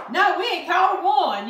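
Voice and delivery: mock innocent no; sarcastic denial. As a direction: lay the sarcasm on thick. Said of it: "he's very sarcastic".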